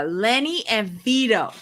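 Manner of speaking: usual speaking voice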